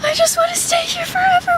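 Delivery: squealed high with joy; thrilled. Real, not acted. Telling viewers she loves the beach, squealing high. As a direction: gush it out, overjoyed.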